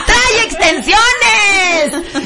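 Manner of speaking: high, stretched-out laughing cry; laughing punchline. Pretend. Host teasing about the blonde's hair, laughing punchline. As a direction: draw it out high through laughter.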